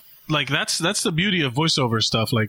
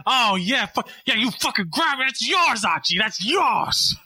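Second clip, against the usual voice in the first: mock moaning, pushed higher; hammed-up aggressive wife; aggressive Edith; 8 semitones higher than usual. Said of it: "her voice would drop, like, aggressively".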